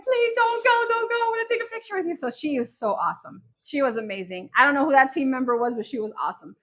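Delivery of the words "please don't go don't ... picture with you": high, squeaky begging in a rush; re-enacted giddy begging, speeding up; retold excited pleading. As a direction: plead like an overexcited little fan. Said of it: "And I was so excited".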